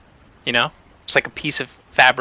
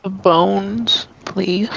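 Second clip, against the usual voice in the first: higher, eerie ghoul voice; ghoulish voice; 7 semitones higher, much slower than usual. Acted. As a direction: ask like a ghoul. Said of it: "stick with a ghoulish voice".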